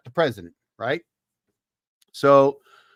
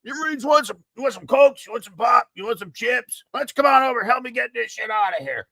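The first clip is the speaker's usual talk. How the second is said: cheerful higher-voiced general offering snacks; general calling out; 9 semitones higher than usual. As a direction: hail the troops like a jovial general. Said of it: "he goes".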